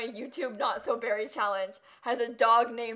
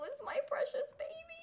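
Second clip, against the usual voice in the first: whispered coo; 7 dB quieter than usual. Real, not acted. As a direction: whisper it tenderly to the pet. Whispers to puppy.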